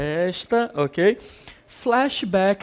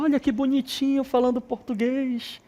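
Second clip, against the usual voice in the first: cooing onlookers; 9 semitones higher than usual. Retold or imitated. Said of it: "Aí todo mundo fala".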